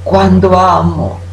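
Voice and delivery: usual speaking voice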